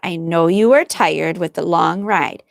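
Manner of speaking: usual speaking voice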